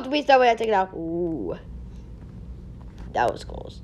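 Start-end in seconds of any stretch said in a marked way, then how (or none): none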